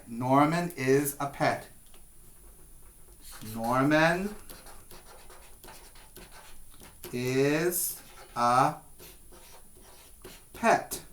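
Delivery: said slowly, word by word; slow modelling. Shown as a demonstration. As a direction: model the sentence slowly for the class. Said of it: "That's right".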